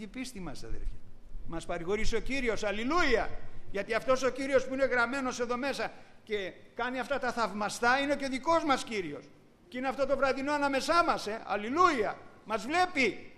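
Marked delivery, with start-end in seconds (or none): 1.5-13.3 loud, high, fervent alleluias; exultant preacher proclaiming the Lord is here; full-force preaching. Acted. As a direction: rouse the congregation with joy.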